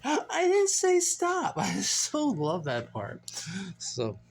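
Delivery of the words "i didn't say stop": high, slow imitation of a woman protesting; mimicked protest. Quoting her favorite part, high slow mimicry. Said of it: "And she said".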